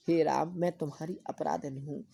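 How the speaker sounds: usual speaking voice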